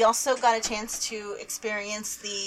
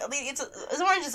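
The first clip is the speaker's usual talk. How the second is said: ordinary talk, nothing marked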